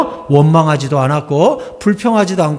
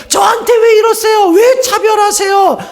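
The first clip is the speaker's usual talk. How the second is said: mock angry outcry. Pastor mimicking a believer shouting complaints at God. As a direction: shout it like a wronged child.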